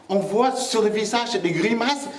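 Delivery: ordinary delivery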